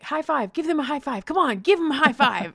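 put-on upbeat parent egging on a baby; excited coaxing. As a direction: cheer a little one into a high five. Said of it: "learning how to be like".

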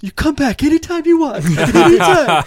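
high-pitched mock eagerness, stretching the last word; eager bar owner. Bar owner invites promoters back after packed show, hammed-up high eagerness. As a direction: imitate a thrilled owner in a high voice. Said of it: "he's just".

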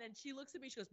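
ordinary talk, nothing marked

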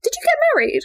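very high, tentative mum question; squeaky mock-casual inquiry; imitated mum. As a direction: ask it like a mum gingerly checking. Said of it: "my mum still texted me like".

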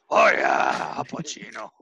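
loud, raspy Pacino shout; Pacino impression. Imagined Pacino unaware of his own voice, a loud shout. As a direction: blast it like Pacino. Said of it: "It was an impression".

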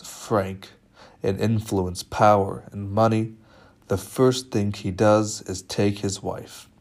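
usual speaking voice

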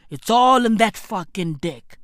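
ordinary talk, nothing marked

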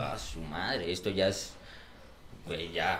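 low, soft grumble trailing to a breath; mild disgusted mumble; muttered aside. Retold or imitated. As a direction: mutter it under your breath.

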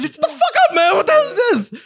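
very high-pitched frantic retelling; hammed-up squealed panic; mock panicked outburst. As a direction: squeal at your friend to shut up, half laughing.

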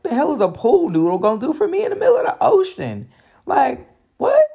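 high, fast, disbelieving squawk of protest; incredulous outburst. Retold or imitated. To the guide about the pool noodle, high incredulous outburst. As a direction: throw the question back at him in disbelief.

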